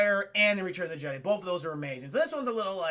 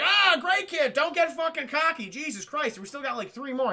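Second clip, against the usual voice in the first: mock scolding; 6 semitones higher, 3 dB louder than usual. Han scolding Luke.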